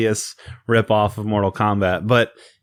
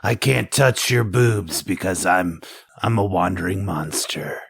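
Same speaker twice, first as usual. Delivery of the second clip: raspy Lambert voice; 4 semitones lower than usual. Retold or imitated. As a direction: brood it like a tortured monster. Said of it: "raspy Christopher Lambert".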